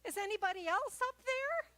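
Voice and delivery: panicked punchline. Retold or imitated. Hiker rejecting the advice, high frantic plea. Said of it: "And he says".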